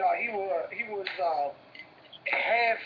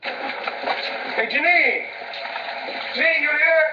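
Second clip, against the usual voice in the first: loud, raised-pitch shout for someone; calling out; 7 semitones higher, 8 dB louder, slower than usual. Real, not acted. Man shouting for Janine to see if she's here, calling out. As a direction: holler out to find whether they're home.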